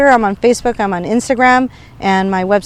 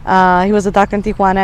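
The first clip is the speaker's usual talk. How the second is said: usual speaking voice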